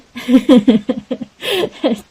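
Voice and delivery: real chuckling laugh, running on; amused run of giggles. Real, not acted. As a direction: laugh it off at your own remark. Laughs at own dislike remark.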